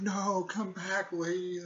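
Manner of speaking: retold cry after departing women; calling after someone. Calling after women who leave.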